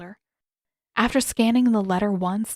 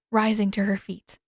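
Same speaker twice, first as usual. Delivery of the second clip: ordinary delivery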